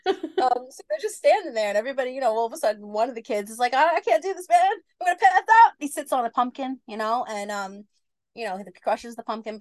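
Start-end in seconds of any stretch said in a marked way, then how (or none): 3.7-5.7 put-on higher, louder, panicking kid; a kid about to faint, hammed up; panicky kid. Kid panics at lightning over the pumpkin patch, hammed-up panic.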